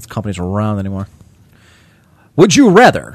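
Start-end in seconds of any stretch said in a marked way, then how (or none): 2.4-3.2 raised, loud game-show call; game catchphrase. Pretend. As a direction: call out the question like a game host. Other player calls out the catchphrase.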